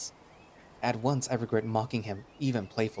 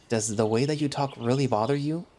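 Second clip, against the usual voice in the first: usual speaking voice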